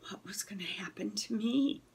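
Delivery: soft breathy whisper of dread; whispered worry. A narrator's reading. Dog worrying to himself, whispered in dread. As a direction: hush it, as if afraid to ask. Scared dog's inner thought.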